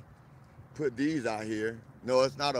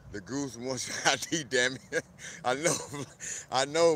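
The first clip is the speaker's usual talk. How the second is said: usual speaking voice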